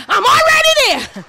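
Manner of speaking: high, loud proclamation; triumphant shout. Acted. Preacher voicing God's answer to the church, shouting. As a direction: ring it out in triumph.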